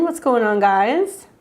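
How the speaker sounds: ordinary delivery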